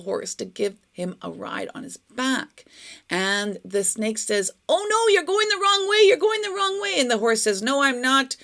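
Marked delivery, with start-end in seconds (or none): 4.7-7.0 very high, loud panicky cry; alarmed snake. A narrator's reading. The snake warns the horse it rides, high-pitched alarm. 7.6-8.4 higher, louder contradiction; stubborn horse. A narrator's reading. The horse contradicts the snake on its back, stubborn. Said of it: "very stubborn".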